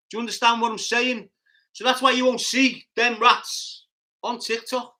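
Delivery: high, loud, worked-up rant. Real, not acted. Streamer ranting to viewers about TikTok, high and heated.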